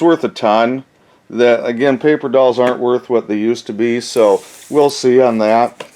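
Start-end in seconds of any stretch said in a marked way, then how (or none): none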